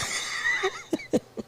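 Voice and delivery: shrieking high breathless laugh; airy squealing laugh; laughter. Real, not acted. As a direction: let a squeal of laughter escape. Host laughing at own prediction.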